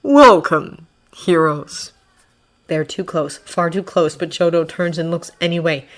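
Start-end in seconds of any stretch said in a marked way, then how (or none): 0.0-1.9 slow, ringing, ominous greeting; tolling funeral bell. A narrator's reading. Villain greets the gathered heroes, ominously ringing out. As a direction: let the first word ring out, then drop. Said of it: "voice rings out like a tolling funeral bell".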